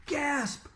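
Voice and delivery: high mock gasp word; spoken gasp. Class reacting to the lawsuit, high mock gasp. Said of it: "we all gasped".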